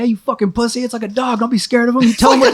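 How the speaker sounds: high-pitched mock scolding; mad boss. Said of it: "my boss gets mad at me".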